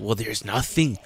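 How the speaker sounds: normal talking voice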